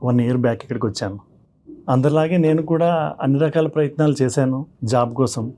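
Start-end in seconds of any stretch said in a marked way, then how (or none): none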